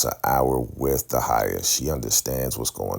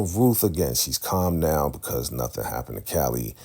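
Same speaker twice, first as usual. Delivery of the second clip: normal talking voice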